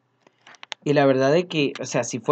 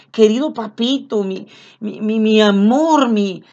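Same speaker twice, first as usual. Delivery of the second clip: put-on a lightly childlike loving prayer voice; sweet tender plea; 6 semitones higher, 4 dB louder than usual. Shown as a demonstration. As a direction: coo it tenderly, asking nicely. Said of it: "mira el tono de voz".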